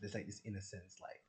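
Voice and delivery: usual speaking voice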